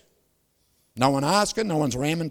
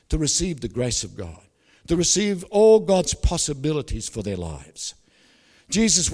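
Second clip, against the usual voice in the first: measured prayer in separated phrases; public prayer; 2 semitones lower, 3 dB louder than usual. Real, not acted. Preacher praying over his congregation to close, earnest prayer.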